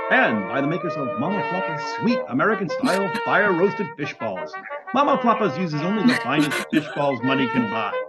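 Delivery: ordinary talk, nothing marked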